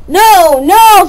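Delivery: high, loud no; loud protest. Acted. Freight cars shouting as they push Thomas. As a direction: shout them down gleefully. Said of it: "said the freight cars, bashing their buffers".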